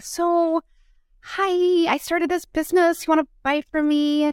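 high, hesitant mock sales approach; awkward sales pitch.

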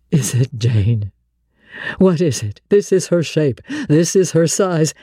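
deep, wondering man; low Rochester voice. A narrator's reading. Blind master recognising Jane by touch, low and amazed.

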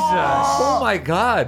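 slow, high, stunned drawl. Real, not acted. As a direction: drag out the shock. Reaction to natural twenty.

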